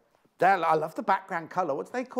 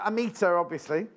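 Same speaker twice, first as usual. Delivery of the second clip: ordinary talk, nothing marked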